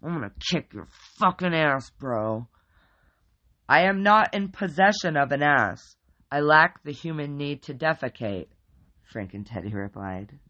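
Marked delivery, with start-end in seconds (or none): 0.0-2.5 loud macho bro voice; loud bro threat; tough guy threat. A narrator's reading. Mike threatens the bear. 3.7-8.5 loud, booming formal pronouncement; loud booming teddy bear. A narrator's reading.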